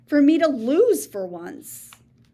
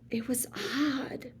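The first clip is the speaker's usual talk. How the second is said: whisper; 9 dB quieter than usual. A narrator's reading. Hushed reveal in read-aloud story.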